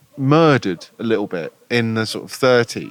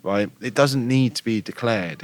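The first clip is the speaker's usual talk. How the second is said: ordinary delivery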